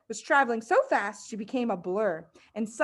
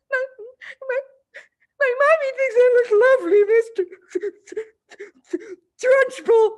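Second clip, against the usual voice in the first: very high, loud, quick stammering plea; frightened stutter; 11 dB louder than usual. Acted. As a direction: plead through terror, tripping on words. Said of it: "Amanda stuttered".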